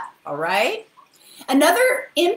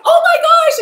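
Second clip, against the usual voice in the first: high loud gasp of delight; surprised; 10 dB louder than usual. Retold or imitated. Seeing the grown-up client again, loud happy surprise. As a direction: shout it in happy shock.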